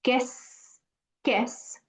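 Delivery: "guess" said like "qué es" fused into one syllable; soft fused syllable; one-word sound. Shown as a demonstration. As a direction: squeeze the vowels into one sound. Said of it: "like one word".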